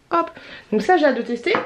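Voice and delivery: ordinary delivery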